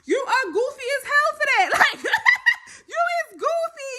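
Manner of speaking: mock her while cracking up; laughing while talking. Real, not acted. Laughing while mocking the girl.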